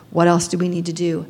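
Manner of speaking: ordinary delivery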